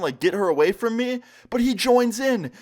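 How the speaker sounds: usual speaking voice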